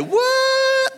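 very high mock what; son's high-pitched shocked what; shocked squeal.

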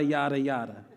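yada as YAH-dah, stress on the first syllable; saying yada for the room. Shown as a demonstration. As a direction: lead the congregation saying yada, yada. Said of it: "Now say yada".

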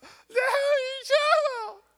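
imitating a crying toddler begging to shovel. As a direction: wail it like a three-year-old in full tears. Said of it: "she starts to cry, like, full".

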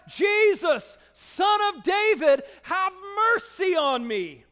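loud desperate plea; crying out. Acted. Blind beggar crying out to Jesus, loud plea. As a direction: call out for mercy at full voice. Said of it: "he began to cry out".

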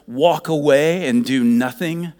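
loud pleading command; forceful warning. Real, not acted. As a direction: drive the warning home loudly.

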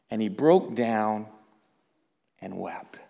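very low, fading almost to a whisper; subdued, grave narration; hushed sorrowful telling. A narrator's reading. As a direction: drop away softly with long pauses. Peter breaks down in the story.

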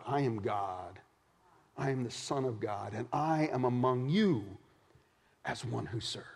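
soft, low, grave words; hushed declaration. Acted. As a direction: say it quietly and solemnly. Jesus declares himself among them.

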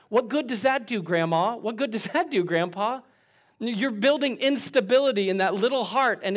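preacher confronting grandma and grandpa; pointed rebuke. Real, not acted. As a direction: call them out directly.

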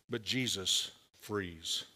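low, soft and slow; slow it down and let it land softly; quiet, slow punchline; slow hushed contrast. Real, not acted. Preacher's slow hushed contrast line.

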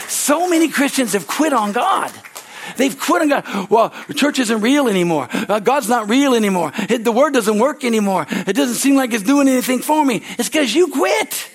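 loud, high, driving preaching; preaching at full force. Real, not acted. Preacher telling Christians not to quit, loud and high. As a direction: push it like a revival preacher.

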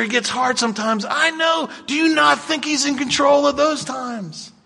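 high, loud, fervent preaching; preaching at full force. Acted. Pastor exhorting the congregation, high loud fervent preaching. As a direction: rouse the congregation to raise their hands.

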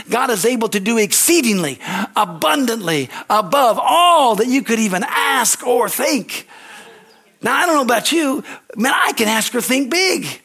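rising sermon shout; full-force preaching. Real, not acted. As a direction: proclaim it to the whole church, building to a shout.

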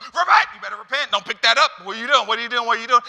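high, loud scolding coach; angry hallway coach. Retold or imitated. Coach in a hallway scolding a student, loud. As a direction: yell like a coach catching a kid. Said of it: "a coach in a hallway going".

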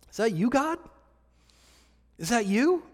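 soft, hesitant question with long pauses; quietly feeling it out, voice tipping upward; tentative asking. Retold or imitated. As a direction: act out a timid, unsure question to God. Acting out Saul's unsure question.

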